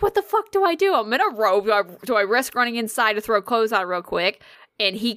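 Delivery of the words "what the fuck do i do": high-pitched panicked outburst, retold; freaking out. Owner in a robe unsure how to save the cat, freaking out. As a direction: blurt it high, on the edge of panic. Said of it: "trying to not freak out".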